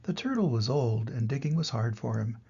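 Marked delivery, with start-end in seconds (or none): none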